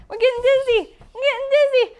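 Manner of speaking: high, loud excited complaint; playful dizzy cry. Pretend. Teacher spins with children, playfully dizzy. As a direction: call out as the room spins. Playing dizzy after spinning.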